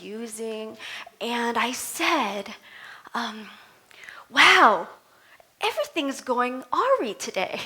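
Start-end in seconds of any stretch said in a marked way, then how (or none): none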